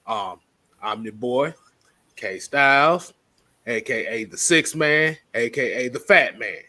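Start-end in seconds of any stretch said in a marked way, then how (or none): none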